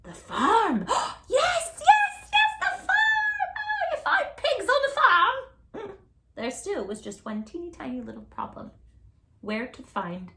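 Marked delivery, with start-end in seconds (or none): 0.0-6.0 ecstatic witch. Acted. Witch ecstatic finding pigs.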